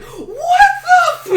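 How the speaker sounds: high, loud laughing mock scream; laughing, slowed mock shriek. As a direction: scream it, cracking up, then cut. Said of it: "cuts out to her screaming".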